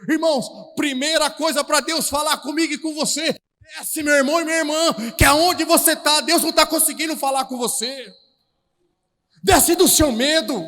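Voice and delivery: loud, rising preacher's call; fiery preaching. Real, not acted. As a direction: preach it out with full force. Preaching builds to full force.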